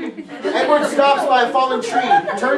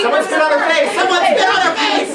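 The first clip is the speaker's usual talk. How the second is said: mock excited shout; 7 semitones higher, 4 dB louder than usual. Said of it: "Does someone wanna grab some water?".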